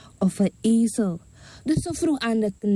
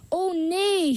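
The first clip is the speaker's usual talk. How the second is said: mother's wail; 9 semitones higher than usual. Acted. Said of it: "gilde de moeder luid".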